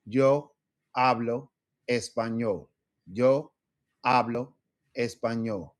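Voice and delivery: yo, hablo, español with a pause after each word; one word per metronome tick; slow to a metronome. Shown as a demonstration. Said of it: "practice with the phrase".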